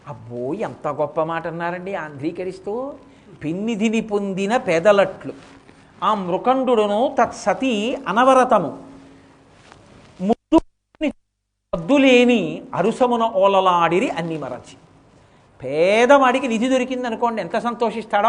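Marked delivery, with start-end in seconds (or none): 3.4-14.8 slow, measured recitation of a poem; verse recitation. Acted. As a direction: chant the verse slowly, savouring each word.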